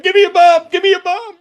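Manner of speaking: high-pitched frantic holler; harried yell across a stage; imitated yelling. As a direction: call out urgently, voice pushed way up. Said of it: "I'm yelling to Craig".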